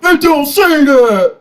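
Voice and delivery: high, drawn-out mock protest; put-on high voice, dragging the denial; mock objecting Muslims. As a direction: imitate a stubborn objector, slow and high. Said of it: "'Cause the Muslims say".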